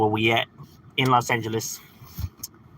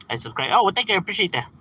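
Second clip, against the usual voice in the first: ordinary talk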